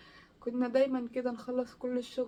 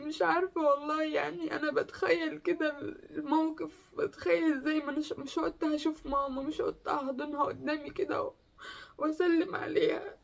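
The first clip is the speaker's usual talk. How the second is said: higher, tearful voice that keeps breaking; still crying; 4 semitones higher than usual. Real, not acted. Daughter imagines hugging her late mother, crying. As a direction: talk on through the tears.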